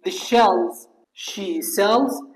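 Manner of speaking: very slow, stretched-out words; slow model reading. Shown as a demonstration. Teacher continues the tongue twister for students, slow model reading. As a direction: draw each word out for the learners.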